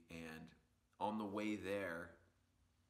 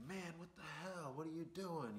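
higher, put-on voice of an upset stranger; angry driver; 9 semitones higher, 4 dB quieter than usual. Jamaican-looking man confronting the kids over the crash, mock upset voice. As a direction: confront the kids who hit your car. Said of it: "he's like".

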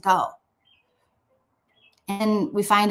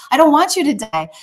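higher, louder mock-distressed plea; imitating a tearful plea, pitched up; retold crying plea; 7 semitones higher, 5 dB louder than usual. King begging dying prophet to live, retold crying plea. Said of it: "he's crying".

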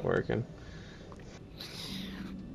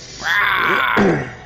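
high, drawn-out mock bear roar; imitated grizzly growl-shout; 7 semitones higher than usual. Roar before naming grizzly bear.

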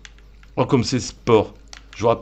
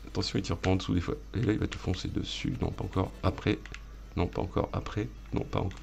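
hushed coaching; 8 dB quieter than usual. Real, not acted. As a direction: hold it back quietly, waiting for the moment. Se guide en attendant le moment. (tout bas, en guettant le moment)

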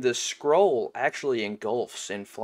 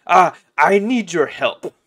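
high, loud, effusive sheep voice; theatrical, thrilled animal character, pitched up; overjoyed talking sheep; 4 semitones higher, 10 dB louder than usual. Acted. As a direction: gush in amazement at being understood.

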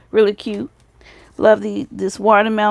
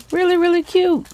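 much higher, gushing cute voice; delighted squeal; 10 semitones higher than usual. Real, not acted. Admiring a crafter's swap pieces, squealing much higher. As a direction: coo over it like a tiny puppy.

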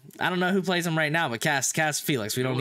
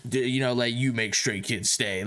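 imitating deep voice; 7 semitones lower than usual. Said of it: "His extremely deep voice".

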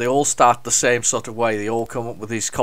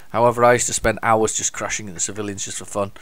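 ordinary talk

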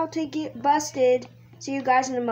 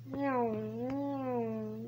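quiet, drawn-out double whoosh; soft zooming noise; 10 dB quieter than usual. Pretend. Player weaving through traffic, soft zoom noises. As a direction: hum the car zooms under your breath.

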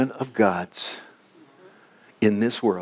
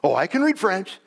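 high, eager boast; proud boys; 9 semitones higher than usual. Retold or imitated. Schoolboys answering the old lady's question, proudly. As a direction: imitate proud schoolboys piping up. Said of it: "they were proud of that".

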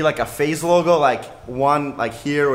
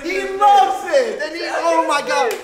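hyped-up; 9 semitones higher than usual. Real, not acted. Host seeing the winner's delight, hyped shouting. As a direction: shout it high and stretch the words. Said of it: "How hyped are you?".

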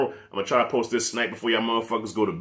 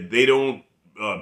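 normal talking voice

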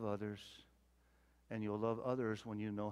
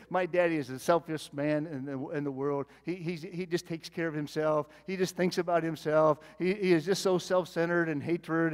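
louder, hammed-up griping about a selfish dad; preacher voicing a hypothetical complaint, louder and mock-bitter; 4 semitones higher, 10 dB louder than usual. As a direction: play the daughter who resents him, half in jest. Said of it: "she said".

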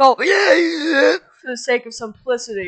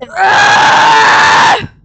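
high loud strained yell; loud stretching groan; 6 semitones higher, 12 dB louder than usual. Real, not acted. Yelling out mid-stretch while telling the story. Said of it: "I had to stretch again".

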